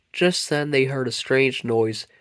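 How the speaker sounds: usual speaking voice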